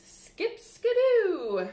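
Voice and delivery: bouncy storybook flourish; playful exclaim. Acted. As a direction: stretch it out with glee.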